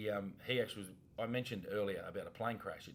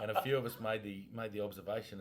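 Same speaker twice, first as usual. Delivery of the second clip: ordinary talk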